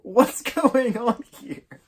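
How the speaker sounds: high, loud, slow motherly demand; hammed-up outraged mom; acted shocked mom. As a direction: burst in and demand an explanation. Acts out mom walking in shocked.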